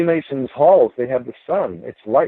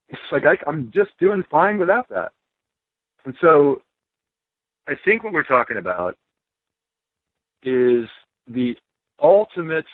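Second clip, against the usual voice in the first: normal talking voice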